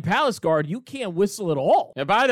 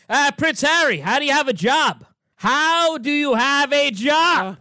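higher, pointed mock grilling of Harry, last line slow; lightly hammed-up tough interview question; mock interviewer grilling; 7 semitones higher than usual. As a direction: play the tough interviewer Doug wanted. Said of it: "what did Doug want Oprah to ask".

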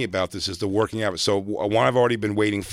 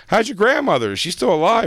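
a high, louder clueless dad; 11 semitones higher, 5 dB louder than usual. Retold or imitated. Dad casually asking his son about grandma, in a high, louder voice. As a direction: chirp it like an oblivious father. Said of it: "he goes, he goes".

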